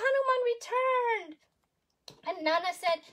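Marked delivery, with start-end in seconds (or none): 0.0-1.4 much higher, louder proclamation; grand announcement. A narrator's reading. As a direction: herald the hero's arrival.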